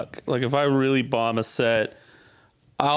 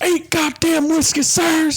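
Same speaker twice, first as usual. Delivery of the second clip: put-on much higher, louder drunken-angry film actor; DiCaprio scene impression; 5 dB louder, slower than usual. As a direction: snarl the line at yourself in the mirror. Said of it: "my favorite scene of any movie".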